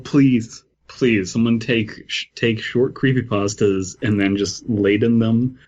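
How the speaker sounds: usual speaking voice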